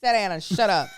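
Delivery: loud, firm scolding; mock parental order. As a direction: lay down the law in play.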